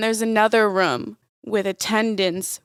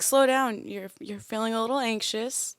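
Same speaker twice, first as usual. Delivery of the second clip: soft, soothing gentle-parent voice, much quieter; calm yourself down like you would a scared kid; gentle self-talk; 2 semitones lower, 3 dB quieter than usual. Retold or imitated. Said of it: "I'm like".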